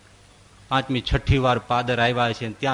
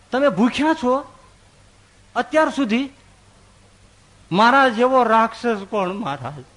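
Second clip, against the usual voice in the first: distressed outburst; 7 semitones higher than usual. Acted. Patel rebukes himself.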